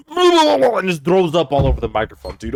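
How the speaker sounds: loud disgusted rant. Real, not acted.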